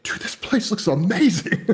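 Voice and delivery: high, slow drawn-out amazement; amazed. Retold or imitated. Retelling first watching the Tehran video, high slow amazement. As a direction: let the wow stretch out. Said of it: "I was like".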